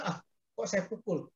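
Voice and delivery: quietly voiced pang of regret; regretful. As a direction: murmur it to yourself with mild remorse. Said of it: "Saya rasa menyesal begitu".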